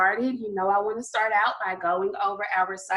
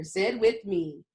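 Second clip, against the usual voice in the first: ordinary talk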